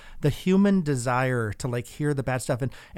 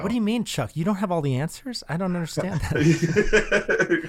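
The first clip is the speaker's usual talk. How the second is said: higher, teasing fake shock with a laugh; mock disbelief; 5 semitones higher than usual. Host teasing the guest about not having all the answers, mock disbelief. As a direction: pretend to be scandalised.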